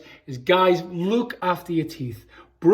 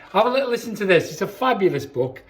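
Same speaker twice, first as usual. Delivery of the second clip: ordinary delivery, nothing marked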